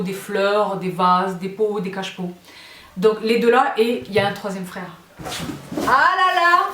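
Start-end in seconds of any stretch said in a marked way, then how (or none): 5.9-6.7 high, drawn-out coo of admiration; gushing exclamation. Real, not acted. Showing viewers the third brother, high gushing exclamation. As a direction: gush in a raised, stretched voice.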